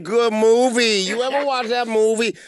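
sassy woman voice. Acted. S0 in character as woman.

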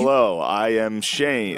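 slow, word-by-word beginner English; real basic English. Retold or imitated. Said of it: "like, real basic".

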